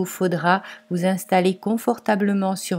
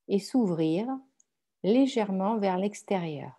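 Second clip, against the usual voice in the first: guided meditation; 4 dB quieter than usual. Acted. Guides a yoga nidra meditation. (méditation guidée, voix douce et posée)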